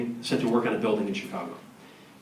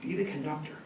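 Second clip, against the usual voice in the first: put-on softly thinking aloud in plain accent; quiet musing; 2 semitones higher, 7 dB quieter than usual. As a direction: mull "Be the conductor" over quietly. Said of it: "I start thinking".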